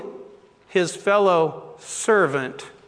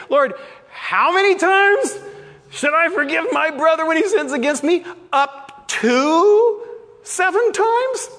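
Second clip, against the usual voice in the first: high, pleading Peter, stretching "seven times?" up high; a disciple hoping for a loophole, much higher and pleading; 9 semitones higher than usual. Acted. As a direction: Peter fishing for a small number. Voicing Peter wheedling for wiggle room.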